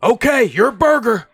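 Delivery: high loud mock bellow; retold yell. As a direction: yell it out at full voice, acting someone else. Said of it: "He yells".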